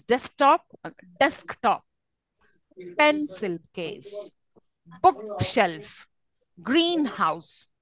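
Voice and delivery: "desktop", "pencil case", "bookshelf", "greenhouse" stressed on the first word; measured word list, first half of each compound stressed; stress on first part. Shown as a demonstration. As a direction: hit DESK, PENCIL, BOOK and GREEN. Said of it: "on the first part".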